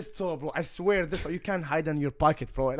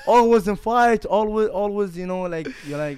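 a hint of an indignant friend, pitched higher and louder; mock accusing friend; 8 semitones higher, 10 dB louder than usual. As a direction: replay the friend scolding him, hammed-up. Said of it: "He's like".

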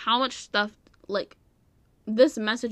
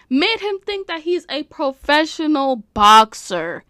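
higher, louder, each word hammered; loud, pitched-up exasperation; loud disbelief; 4 semitones higher, 6 dB louder than usual. Real, not acted. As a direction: hammer out "professional boxer" incredulously. Repeats line in loud disbelief.

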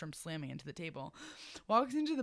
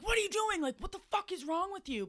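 high-pitched imitation of an angry friend; mock tirade, pitched way up; pissed off; 13 semitones higher than usual. Pissed friend confronting Caitlin at night, high mock yelling.

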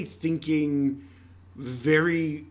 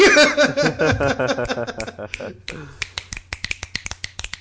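loud laughter; 8 semitones higher, 6 dB louder than usual. Real, not acted. After the Dang monologue.

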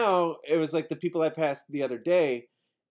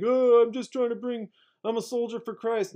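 higher, smug soldier-for-Christ voice; pitched-up mock believer; mock preacher voice; 10 semitones higher than usual. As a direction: parody the earnest preacher. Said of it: "he's like".

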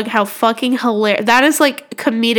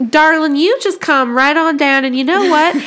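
higher, imitated Southern hostess; Southern US accent; 3 semitones higher than usual. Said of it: "that's Southern hospitality".

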